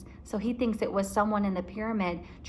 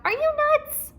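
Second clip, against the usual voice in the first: high, louder disbelief; incredulous Jack; 5 dB louder than usual. A narrator's reading. Jack scolding Annie for calling, incredulous. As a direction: scoff at her idea. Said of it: "Jack scolding Annie".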